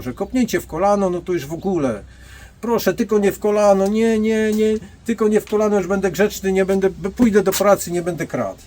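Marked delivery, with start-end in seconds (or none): 2.6-8.6 high, loud, hammed-up pleading; mock begging thief. Bouncer mocks the thief begging not to be kicked in the knee, high and pleading. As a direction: beg for mercy in a mocking whine.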